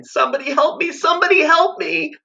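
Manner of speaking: put-on high panicked child's plea; terrified pleading. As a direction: plead like a terrified boy running. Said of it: "Wendell was sobbing as he ran".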